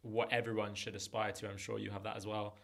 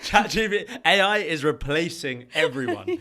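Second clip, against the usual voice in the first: laughing through the words, much louder and higher; 6 semitones higher, 14 dB louder than usual. Real, not acted. Guest joking AI replaces everyone, laughing loudly. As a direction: crack up while mocking AI taking over.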